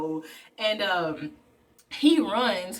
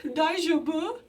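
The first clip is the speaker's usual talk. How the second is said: "Daijoubu?" asked a bit higher with a rising end; worried question; 6 semitones higher, slower than usual. Retold or imitated. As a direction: ask gently if he's okay. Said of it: "And she's like".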